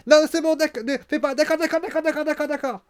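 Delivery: high, loud, frantic pleading; panicked giving in. Retold or imitated. Bayley begging Becky to spare Dakota, high frantic panic. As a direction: blurt it out in panic to stop her.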